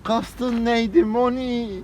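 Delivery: high, slow theatrical question; slow dramatic drawl. Pretend. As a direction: stretch every word out high. Slow stretched mock question.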